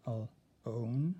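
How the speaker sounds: alone broken as al-, own; split into syllables. Shown as a demonstration. Teacher splitting alone so class hears the O. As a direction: pull alone apart at the O. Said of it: "hear the O".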